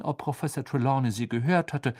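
usual speaking voice

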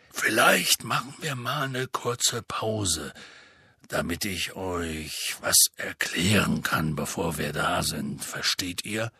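slow, low voice of a hesitant big man; Hagrid. Acted. (langsame, tiefe Hagrid-Stimme, zögernd)

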